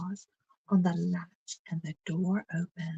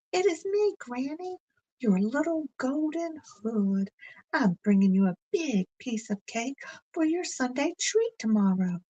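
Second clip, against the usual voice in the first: put-on bright, much higher little girl voice, a bit louder; 6 semitones higher, 4 dB louder than usual. Blanche calls to Granny through the door, high and eager. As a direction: sing out like an eager child at the door.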